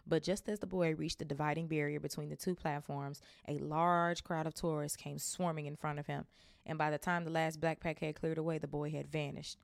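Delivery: ordinary delivery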